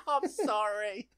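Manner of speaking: loud, high confession breaking into laughter; laughing wail. Real, not acted. Host owning up to listeners in episode two, laughing wail. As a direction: wail it to the audience, helpless with laughter.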